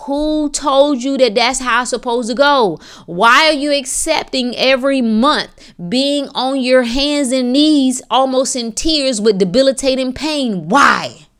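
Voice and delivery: high, forceful rhetorical questioning; impassioned challenge. Real, not acted. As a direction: demand answers like a fired-up preacher.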